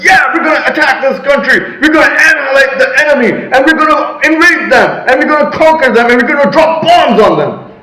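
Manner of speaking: a president declaring war, shouting; assertive shout. Retold or imitated. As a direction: bellow it like a commander rallying troops. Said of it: "you're gonna be assertive".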